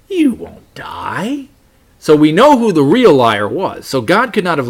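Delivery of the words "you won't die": slow hissing whisper of the tempter; devil's lie. Retold or imitated. Teacher voicing the devil's lie to Eve, whispered. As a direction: whisper it like a sly serpent. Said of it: "he's the one that said".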